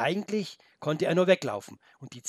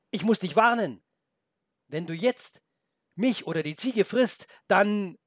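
put-on higher, urgent boy's voice; boy's warning; 7 semitones higher, 5 dB louder than usual. The boy warns the wolf, high and urgent. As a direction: warn him urgently as a small boy.

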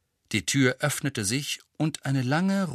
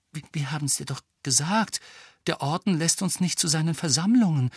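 higher, stammering nervous defence; 6 semitones higher than usual. A narrator's reading. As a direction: explain nervously, stumbling at the start. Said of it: "sagte Hermine nervös". (nervös, leicht stotternd)

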